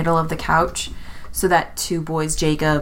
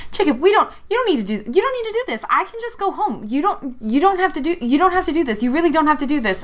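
much higher, rushed frantic begging; panicked pleading; 11 semitones higher, much faster than usual. Acted. Carlos begs Jacob to let him go home, high panicked pleading. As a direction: beg for your life, voice climbing high. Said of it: "Carlos turned to Jacob and he was like".

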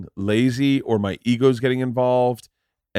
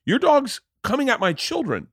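indignant; 11 semitones higher than usual. Retold or imitated. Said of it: "And I go".

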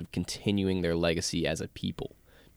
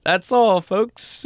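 cartoon sign-off; 10 semitones higher, 5 dB louder, much slower than usual. Jokingly ending the show.

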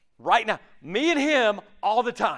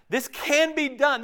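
excited; 7 semitones higher than usual. Real, not acted. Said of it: "He's saying this can be done now".

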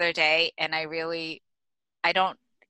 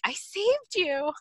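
high and slow, not actually louder; said really loudly; 11 semitones higher, slower than usual. Retold or imitated. Owner telling her old dog she's rescued, high and slow. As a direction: proclaim it in a raised, drawn-out pitch. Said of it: "I say really loudly".

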